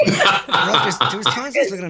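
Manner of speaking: giggling retelling of the scary guest; laughing. Real, not acted. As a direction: laugh through the words.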